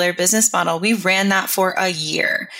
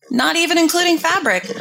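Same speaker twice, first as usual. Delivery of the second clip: incredulous squeak; 9 semitones higher than usual. Acted. Repeats phrase in disbelief.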